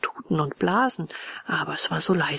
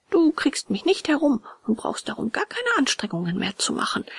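put-on resolute puppet boy; 7 semitones higher than usual. A narrator's reading. Puppet refuses friend, promised his fairy mother, high resolute voice.

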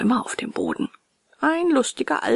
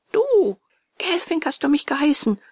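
higher, louder accusing shout on Du; furious accusation; 6 semitones higher, 3 dB louder than usual. A narrator's reading. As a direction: jab the blame at him. Escalating quarrel, furious accusation. (wütende Anschuldigung)

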